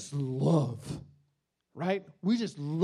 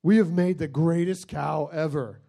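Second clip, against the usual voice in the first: dopey adoration; 2 semitones lower, 3 dB louder, much slower than usual. Retold or imitated. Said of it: "and you went".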